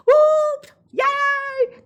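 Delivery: very high, loud cheering; joyful whoop. Acted. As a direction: villagers celebrating the win, high joyful whoops. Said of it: "They whooped and hollered".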